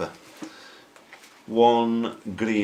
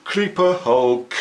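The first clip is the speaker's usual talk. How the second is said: big announcer voice; 5 semitones higher, 10 dB louder, slower than usual. Acted.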